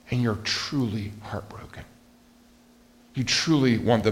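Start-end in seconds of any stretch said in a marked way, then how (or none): 0.1-1.8 breathy hush, barely voiced; whispered. Pastor to congregation, on truly caring, whispered. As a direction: drop to a whisper to land the feeling. Pastor stressing heartfelt compassion.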